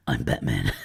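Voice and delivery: low gruff Batman growl. Pretend. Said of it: "Batman quote".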